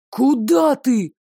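slow loud call; shout. Acted. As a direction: call after her loudly. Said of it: "крикнул Девислав".

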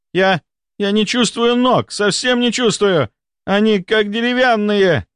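high, stammering fake despair; panicking baron. A narrator's reading. Baron hams up fake paralysis in front of the ladies. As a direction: overplay the terror of losing his legs.

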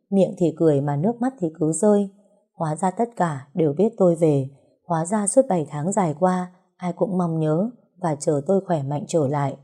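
plain reading voice

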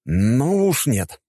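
high, stretched-out objection; indignant, drawn-out protest; firm refusal. A narrator's reading. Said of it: "не согласился с ним я".

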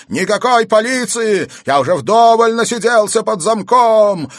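very high, loud wailing demand; madman's yell. A narrator's reading. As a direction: yell it like an escaped lunatic. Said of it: "рявкнул Пельт". (истошный вопль безумца)

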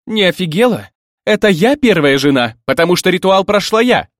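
loud, high indignant outburst; outraged quarrel voice. A narrator's reading. First wife putting a newcomer in her place, outraged shouting. Acted quarrel between rival brides.

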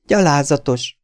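high, loud outraged exclamation; crying out. A narrator's reading. Girl answers the blackmailer, indignant cry. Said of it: "kiáltotta a lány".

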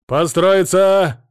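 loud, slow barked drill command; sergeant's order. A narrator's reading. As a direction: bellow it across the square. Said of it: "раздался голос расталкивающего толпу фельдфебеля".

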